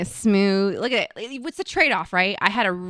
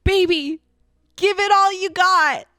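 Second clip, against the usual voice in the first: husband cheering; 9 semitones higher, 4 dB louder than usual. Retold or imitated. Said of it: "So Brennan goes".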